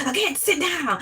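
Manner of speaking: high-pitched Southern rooster-like bluster; Southern teacher impression. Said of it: "He sound like Foghorn Leghorn".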